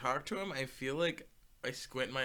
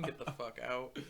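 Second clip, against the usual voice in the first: ordinary talk, nothing marked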